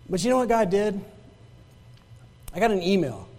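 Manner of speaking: normal talking voice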